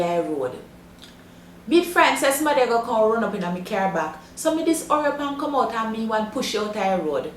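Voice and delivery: broad Jamaican patois storytelling. Shown as a demonstration. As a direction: tell it in straight Jamaican patois. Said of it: "listen to it again".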